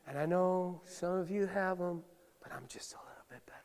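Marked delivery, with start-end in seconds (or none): 2.4-3.6 whispered, quick and smug; a self-satisfied whisper. Retold or imitated. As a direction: breathe it like a proud secret. Smug aside about tattoos.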